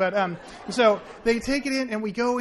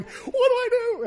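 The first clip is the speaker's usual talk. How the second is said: much higher, louder panicked cry; freaking out; 7 dB louder than usual. Retold or imitated. Friend panicking while paramedics work, high mock panic.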